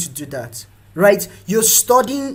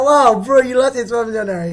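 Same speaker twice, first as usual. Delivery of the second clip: loud, high, rushed disbelief; mock amazement; 6 semitones higher, 8 dB louder than usual. Friends hearing of the huge loss, mock amazement. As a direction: exclaim like an astonished pal.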